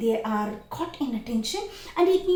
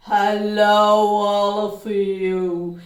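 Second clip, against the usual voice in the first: louder gruff voice, very slow drawn-out words; gruff whale voice; 2 semitones lower, 10 dB louder, much slower than usual. Shown as a demonstration. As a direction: boom it like a big whale. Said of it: "with the gruff voice".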